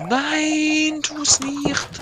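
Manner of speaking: high, frantic cry of no; panicked plea. Real, not acted. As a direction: shriek to stop him. Friend about to steal his eggs.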